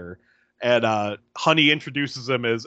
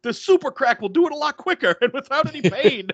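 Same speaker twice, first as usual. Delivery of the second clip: high-pitched imitation of a yell; mock yelling; 12 semitones higher than usual. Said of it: "Jeffrey's yelling, he goes".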